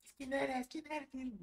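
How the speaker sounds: high, softened mock shouting; retold shouting. Group demands who you are, high hushed mock yell. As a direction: imitate insistent yelling in a small high voice. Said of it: "te empiezan a gritar y gritar".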